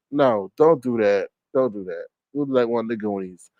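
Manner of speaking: dropping to a soft, low plea; quiet plea. Real, not acted. Streamer quietly begging Olivia to change her avatar, hushed plea. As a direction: softly beg her to stop.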